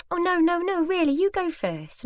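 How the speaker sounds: high-pitched mock-polite refusal; polite protest. Lady insisting the shopper goes first, politely firm.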